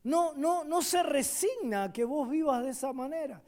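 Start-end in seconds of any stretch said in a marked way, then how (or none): none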